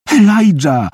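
high, piercing cry for help; panicked high-pitched scream; desperate squeal. A narrator's reading. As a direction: shriek the name in desperation. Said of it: "piszczała desperacko".

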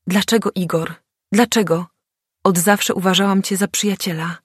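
a plaintive moan, voice cracking; hurt narrator moaning at a friend, voice cracking; voice breaking. A narrator's reading. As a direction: plead with a breaking voice. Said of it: "jęknęłam łamiącym się głosem".

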